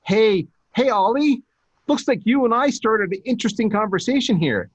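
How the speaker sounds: high upbeat friendly outreach; bright pitch. Retold or imitated. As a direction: sound keen to the influencer you admire. Pitching an influencer, cheery quote.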